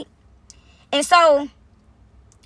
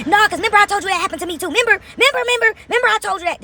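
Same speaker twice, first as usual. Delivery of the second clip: higher, fast, pushy mimicry of the friend; loud obnoxious insistence; 7 semitones higher than usual. Mocking the friend who insists on the lie, higher and pushy. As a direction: hammer "remember" over and over, imitating her. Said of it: "This person was so loud and obnoxious".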